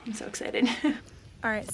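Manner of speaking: usual speaking voice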